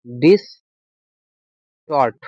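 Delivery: Distort split into "dis", long gap, "tort"; teacher breaking distort up for the class; slow dictation. Shown as a demonstration. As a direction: say distort very slowly in two separate parts. Teacher repeats word slowly to dictate.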